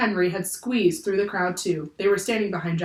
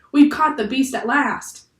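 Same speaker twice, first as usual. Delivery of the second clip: higher, louder narrated shout; shouted announcement; 6 semitones higher, 6 dB louder than usual. A narrator's reading. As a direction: call the news across the deck. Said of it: "shouted Joe".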